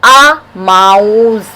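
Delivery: आ and माउस spoken very slowly, each sound drawn out; slow sound demo. Shown as a demonstration. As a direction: stretch out आ, माउस to show the consonant sound. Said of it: "consonant और sound भी वही आ रही है". (आ, माउस को बहुत धीरे, हर ध्वनि खींचकर)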